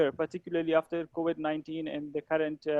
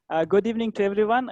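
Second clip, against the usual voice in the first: louder, brisk greeting; raised volume, quick pace; audible, louder; 5 dB louder, faster than usual. Real, not acted. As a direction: speak up quickly so everyone can hear. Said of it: "Am I audible now?".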